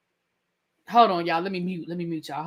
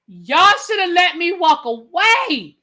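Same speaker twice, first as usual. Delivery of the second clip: louder, slow, drawn-out complaint; exasperated outburst; 4 semitones higher, 5 dB louder, slower than usual. Real, not acted. Exasperated, telling viewers he should have stopped watching. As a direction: stretch it out, fed up.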